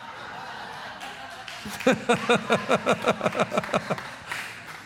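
long, rapid chuckling laugh; soft, drawn-out run of giggles; long chuckle. Real, not acted. Preacher amused at own bankruptcy pun, long chuckle.

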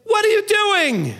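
high, loud, slow outburst; exasperated shout. Retold or imitated. Parent catching a child in the act, shouting. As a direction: yell it like you caught a child. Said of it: "looked at them and said".

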